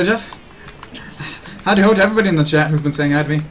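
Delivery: fast, peppy cartoon greeting; quick howdy ho. Streamer greeting the whole chat, quick and hyped. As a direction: rattle off a hyped hello to all. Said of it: "talk really quickly and say howdy ho".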